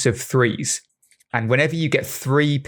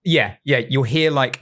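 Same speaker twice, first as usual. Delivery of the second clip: normal talking voice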